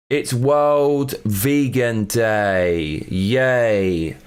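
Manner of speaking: very slow, drawn-out fake cheer; unenthusiastic, dragged-out hooray; mock celebration. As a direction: stretch every word in sarcastic celebration. Sarcastic cheer for vegan day.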